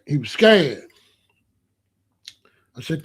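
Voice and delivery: normal talking voice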